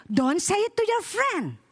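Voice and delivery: high, slow, drawn-out retort; coached comeback. Shown as a demonstration. Father teaches child a reply to a friend, emphatic coaching. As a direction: spell out the comeback slowly and high.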